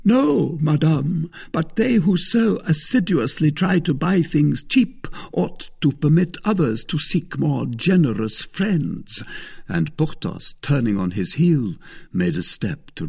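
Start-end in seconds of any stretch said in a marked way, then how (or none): 0.0-9.2 loud, higher-pitched rebuke; haughty Musketeer. A narrator's reading. Porthos rebukes the thrifty wife, haughty. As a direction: put them in their place, wounded pride ringing.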